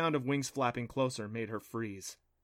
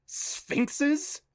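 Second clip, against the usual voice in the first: high, startled splutter; high-pitched stunned question; spluttered disbelief; 13 semitones higher than usual. A narrator's reading. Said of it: "he spluttered".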